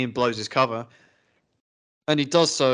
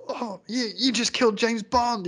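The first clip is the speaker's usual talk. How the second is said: high-pitched mock shock, retold; mock astonishment; 9 semitones higher than usual. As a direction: imitate a stunned film character, much higher. Acting out famous shocked film line.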